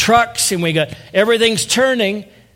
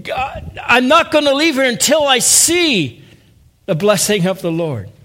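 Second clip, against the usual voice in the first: crying out; 5 semitones higher than usual. Retold or imitated. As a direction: refuse to leave without the blessing. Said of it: "That kind of crying out".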